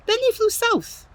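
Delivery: high storybook lilt; high flying voice. A narrator's reading. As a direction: lift it up as they fly off. Birds flying away.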